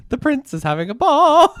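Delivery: high laughing proclamation; royal herald. Pretend. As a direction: announce it like a town crier.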